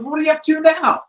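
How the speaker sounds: high, fast, gushing fan voice. Retold or imitated. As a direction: gush like a starstruck rocker. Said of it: "He goes".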